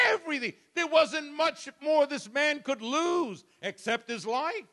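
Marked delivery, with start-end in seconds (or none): 0.1-3.4 sudden high shout; preacher's peak cry on the last loss; shouted climax. Acted. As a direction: fling the word out at the top. Climax of Job's losses.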